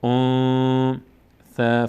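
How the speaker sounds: انثا with a long nasal hum in place of a clear ن; ikhfa on انثا. Shown as a demonstration. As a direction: hold the hidden ن of انثا in the nose, long and drawn out.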